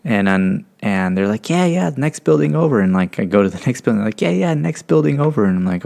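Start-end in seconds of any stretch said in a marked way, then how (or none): 1.5-2.8 higher-pitched, offhand imitation; breezy dismissal. Strangers sending lost new airman onward, breezy dismissal. 4.2-5.4 higher-pitched, offhand imitation; breezy dismissal. Strangers sending lost new airman onward, breezy dismissal. Same directions retold at next building.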